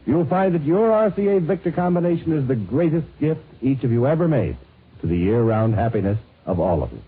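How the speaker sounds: usual speaking voice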